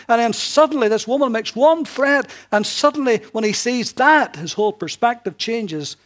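loud, high, ringing preacher's voice; preaching at full force. Acted. Preacher booming the story to the congregation, full force. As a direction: proclaim it from the pulpit.

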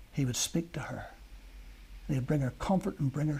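breathy hushed whisper, barely voiced. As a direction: lean in and whisper it like a secret. Tender climax of Mary story.

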